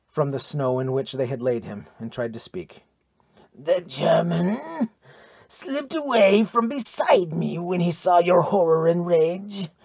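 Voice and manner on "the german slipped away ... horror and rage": slow, strained, choking words; halting, throat-choked gasp of speech; choked dying voice. A narrator's reading. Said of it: "Thialfi choked to the princess".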